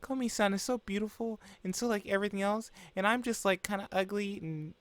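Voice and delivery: put-on high, girlish inner monologue; high-pitched shy narrator; put-on narration voice. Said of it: "It's just me doing the effect for that".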